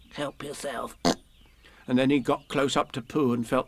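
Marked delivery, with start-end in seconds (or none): 0.1-1.0 very soft little piglet voice; tiny, hushed storybook piglet. A narrator's reading. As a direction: offer it timidly and very softly. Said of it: "Piglet pushed the bowl".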